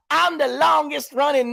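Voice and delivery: mock him tooting his own horn; hammed-up imitation of a braggart; mocking boast. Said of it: "tooting your own horn about".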